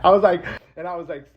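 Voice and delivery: usual speaking voice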